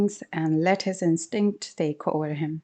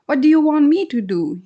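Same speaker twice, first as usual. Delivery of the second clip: louder, higher scared yell; 7 semitones higher, 7 dB louder than usual. Acted. Husband yelling back at wife in panic, scared. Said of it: "yelled out".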